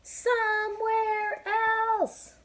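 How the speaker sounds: very high, loud, slow singsong; very high drawn-out reply; Piggy answer. Acted. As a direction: chirp it out breezily.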